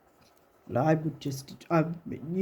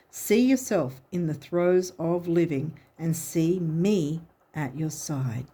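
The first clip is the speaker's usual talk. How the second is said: normal talking voice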